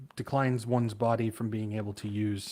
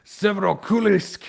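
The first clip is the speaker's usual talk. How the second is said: Russian accent; 8 semitones higher, 8 dB louder than usual. Shown as a demonstration. Reading quake locations, hams it in a Russian accent. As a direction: place name hammed up in a Russian accent, higher and louder. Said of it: "like, the Russian accent".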